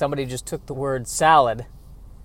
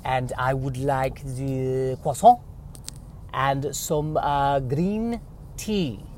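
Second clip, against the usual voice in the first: put-on slow, halting English with a French accent; 6 dB quieter, slower than usual. Customer ordering croissant and green tea, slow French accent. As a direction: put on a hesitant French accent, groping for words. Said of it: "English with a French accent".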